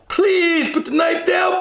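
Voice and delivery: high, slow mock police plea; pleading cop. Cop begging a knifeman, high slow plea. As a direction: drag out the begging like the officer. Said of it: "beg, beg, beg".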